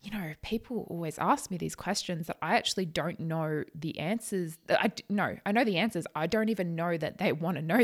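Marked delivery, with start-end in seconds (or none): none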